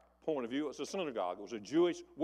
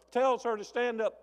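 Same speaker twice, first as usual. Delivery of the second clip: ordinary talk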